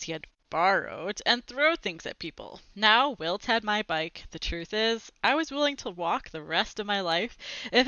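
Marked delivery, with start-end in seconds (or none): none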